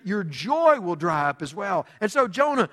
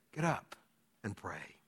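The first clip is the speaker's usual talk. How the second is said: very soft, sinking lower; hushed urging; 11 semitones lower, 14 dB quieter than usual. Acted. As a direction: say it low and grave, with a pause. The lost quietly urge Jonah.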